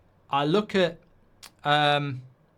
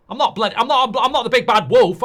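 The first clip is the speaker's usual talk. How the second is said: playful loud denial; 7 semitones higher, 8 dB louder than usual. Pretend. Joking he's not the wolf.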